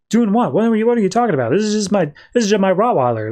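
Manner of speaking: high-pitched, stumbling bewilderment; baffled questioning. Retold or imitated. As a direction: act out someone puzzled by the fuss. Psychiatrist baffled by patient's panic.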